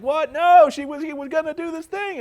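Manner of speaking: sudden high, loud outburst of disbelief; indignant surprise. Retold or imitated. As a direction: squeal it in shocked protest. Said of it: "I was like".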